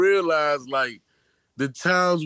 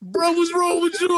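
mock alarm squeaked out between laughs; laughing imitation; 14 semitones higher than usual. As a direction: crack up while playing the shocked friends. Retelling friends' reaction, laughing.

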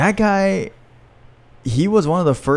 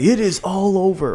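imitating Goldberg; 7 semitones higher than usual. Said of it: "Was he the guy that was like".

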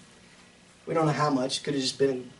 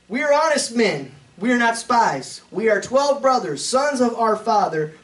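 scripture reading; 7 semitones higher, 5 dB louder than usual. Acted. Reads scripture aloud in sermon.

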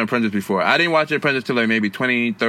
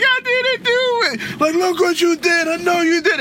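very high, slow wailing denial; crying kid. Retold or imitated. Child accused in a prank video, high wailing denial. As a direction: plead like a scared child. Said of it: "And the kids go, like".

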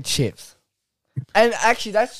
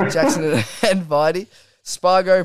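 ordinary talk

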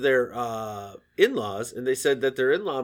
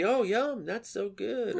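fake polite praise; 6 semitones higher, 7 dB quieter, slower than usual. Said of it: "you always gotta be".

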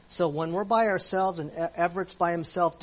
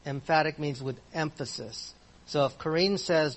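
ordinary delivery

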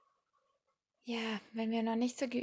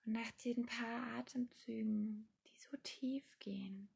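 guided meditation; 8 dB quieter than usual. Acted. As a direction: ease the listener into calm, softly.

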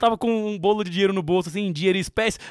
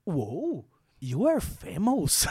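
lower, slow imitation of a tourist in English; mock gringa English; 3 semitones lower, 6 dB quieter, much slower than usual. Retelling the tourist girl, a mock English gush. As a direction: play the foreign girl, amazed. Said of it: "a gringa já olhou e falou".

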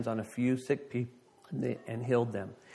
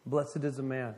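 soft sermon close; 3 semitones lower, 6 dB quieter than usual. Real, not acted.

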